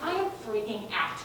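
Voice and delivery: usual speaking voice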